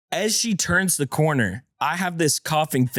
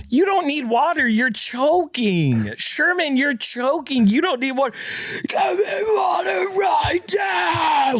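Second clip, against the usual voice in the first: screaming in full panic; frantic yelling during a choking scare, half wife half him; visceral scream; 13 semitones higher than usual. Retold or imitated. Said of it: "visceral scream".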